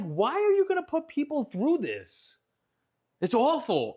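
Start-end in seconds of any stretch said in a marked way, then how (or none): 0.0-2.4 exasperated. Retold or imitated. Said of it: "and I'm like".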